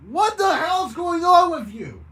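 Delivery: loud, high alarmed yell; shout. Acted. Bert yells at Bennett what is going on. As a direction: yell it out in shock.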